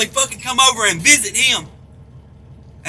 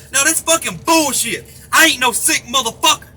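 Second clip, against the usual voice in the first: normal talking voice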